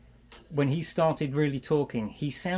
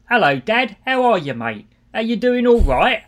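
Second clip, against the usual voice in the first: imitating son's overdone Cockney-ish accent, higher and louder; bad British accent; 8 semitones higher, 11 dB louder than usual. Father imitating son's bad London accent, much louder and higher. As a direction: ham up a London street-kid accent like the son does. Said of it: "the one that he's worst at".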